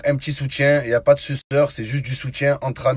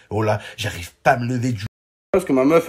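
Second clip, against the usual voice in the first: ordinary delivery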